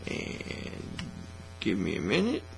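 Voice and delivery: normal talking voice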